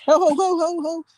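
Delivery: high-pitched "ho ho ho"; string of high startled hoots; excited whooping. Real, not acted. Reacting to popping on stream.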